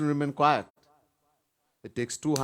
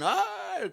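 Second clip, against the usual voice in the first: a sharp, high imitated yelp; high dog's cry of pain. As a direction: yelp like a beaten dog. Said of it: "crying, yelping".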